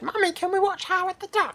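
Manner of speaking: put-on high pleading little kid; squeaky child begging mum. Imagined child asking mum.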